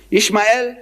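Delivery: "Ishmael" again as ish-ma-EL, rising at the end; "Ishmael" in the Hebrew way, ish-ma-el; Hebrew pronunciation. Shown as a demonstration. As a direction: repeat "Ishmael" as ish-ma-el with a lift. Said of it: "that's how they say it".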